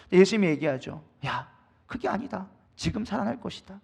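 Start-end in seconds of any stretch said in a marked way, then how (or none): 1.3-3.8 gentle correction. Retold or imitated. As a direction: quietly reassure her, unhurried. Jesus answering sulking Martha.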